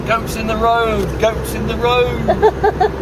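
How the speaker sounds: husband teasing the wife's goat call; mock sing-song. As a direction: parrot her delighted call to tease.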